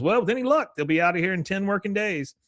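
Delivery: high-pitched realtor voice, lightly acted; retold realtor. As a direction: deliver the prediction high and brisk, playacting. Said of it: "She goes".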